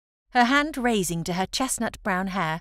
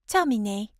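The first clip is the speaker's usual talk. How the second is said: a crisp French Terminé with the final é bright; French accent. Acted. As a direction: exclaim Terminé like a proud Parisian. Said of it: "she exclaimed in an impressive French accent".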